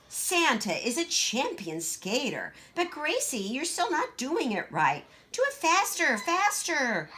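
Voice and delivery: put-on high, bossy little girl voice; Greta, boastful child. Big sister boasting to Gracie, high and bossy. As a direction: show off being taller.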